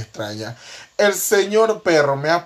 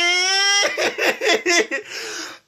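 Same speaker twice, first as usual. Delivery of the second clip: risa alta y desatada; reír a carcajadas; carcajada aguda. Real, not acted. Recuerda su caída. (carcajada aguda)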